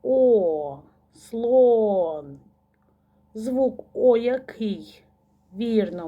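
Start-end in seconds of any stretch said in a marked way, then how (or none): 0.0-0.8 "О" held long and loud; long, emphatic "О"; isolated vowel. Shown as a demonstration. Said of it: "Наступний звук". 1.1-2.4 "Слон" with a long, loud "о"; drawn-out "Слооон" to show the vowel; stretched vowel. Shown as a demonstration. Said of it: "Наступний звук".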